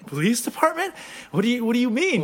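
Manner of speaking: high, startled questioning; mock panic, stammering the question; alarmed disbelief. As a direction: squeak it back in rattled disbelief. Acting the alarmed prank victim.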